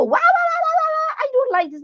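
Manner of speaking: high wailing wah wah like a throwing a fit; mock tantrum wailing. Mocking someone who screams instead of pausing. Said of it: "you don't need to scream and go".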